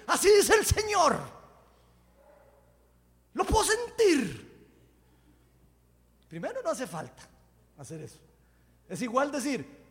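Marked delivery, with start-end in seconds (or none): 0.1-4.7 high, much louder, showy proclamation; dramatic prophet tone. Retold or imitated. Preacher mocking showy prophets, loud theatrical proclamation. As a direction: ham up a grand prophetic announcement. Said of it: "con un tono especial para que la profecía pegue".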